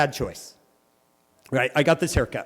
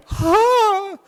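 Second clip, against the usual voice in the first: high shocked cry; a sudden high yelp of shock; shocked gasp; 5 dB louder than usual. Retold or imitated. Teen seeing the drop below the car, a high shocked yelp. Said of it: "Would you be like".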